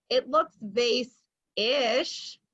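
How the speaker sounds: usual speaking voice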